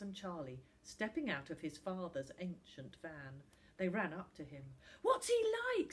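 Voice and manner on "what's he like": very high, loud, eager question; breathless excitement. A narrator's reading. Charlie runs up to ask Finn, breathlessly excited. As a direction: ask in an excited rush of interest. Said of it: "said Charlie breathlessly".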